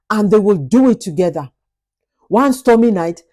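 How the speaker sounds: plain reading voice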